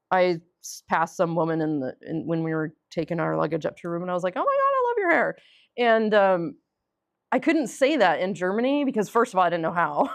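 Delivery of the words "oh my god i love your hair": high, quick gush; gushing compliment. Retold or imitated. Woman complimenting a stranger's hair, gushing. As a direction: squeal a compliment at a passing stranger.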